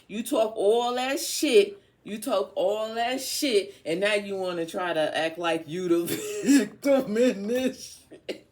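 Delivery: high, shouted rant breaking into laughter; loud taunt. Acted. Calling out Leo while reading the cards, loud taunt. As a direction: hammer it loudly until you crack up. Keeps hammering the taunt, then cracks up.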